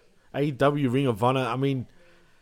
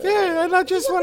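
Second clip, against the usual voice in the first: very high, loud falsetto mimicry; high-pitched voice; 5 dB louder than usual. Co-host mocking the tall wrestler's voice, high-pitched impression. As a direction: squeak it out in a mock falsetto. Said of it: "He's got a high-pitched voice".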